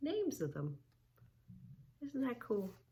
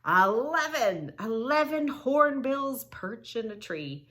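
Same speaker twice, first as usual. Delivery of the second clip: louder, higher excited reveal; excited announcing; 5 semitones higher, 7 dB louder than usual. Shown as a demonstration. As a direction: announce the hornbills with a burst of delight.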